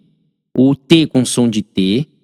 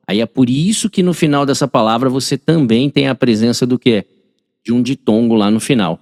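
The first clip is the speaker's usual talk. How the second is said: normal talking voice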